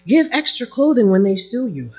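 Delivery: normal talking voice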